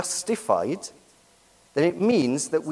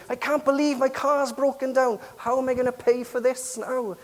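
complaining; 9 semitones higher than usual. Pretend. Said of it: "Could you imagine if I said".

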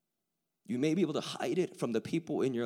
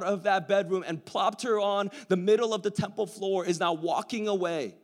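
higher, louder, fervent storytelling; full-force preaching; 9 semitones higher, 8 dB louder than usual. Acted. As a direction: preach the scene out to the crowd.

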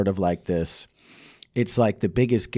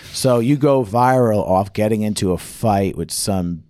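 ordinary talk

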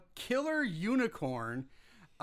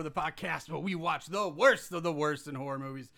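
ordinary delivery

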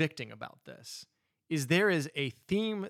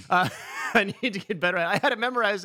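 embarrassed laugh; 5 semitones higher, 6 dB louder, much faster than usual. Real, not acted. Admits he can't recite it.

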